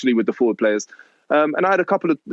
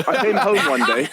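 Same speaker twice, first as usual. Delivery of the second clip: normal talking voice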